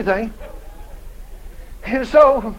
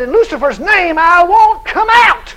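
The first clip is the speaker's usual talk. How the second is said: high, loud, slow shouted refusal; mock demon voice yelling back; defiant devil; 10 semitones higher, 6 dB louder, slower than usual.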